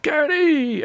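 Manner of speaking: high, excited call across the street; calling out a name. Retold or imitated. Friend in the shop calls Gary over, high excited call. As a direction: hail him happily from the shop.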